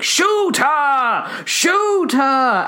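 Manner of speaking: shouted order. Retold or imitated. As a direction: yell it like a hunter ordering the shot. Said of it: "I can see him doing".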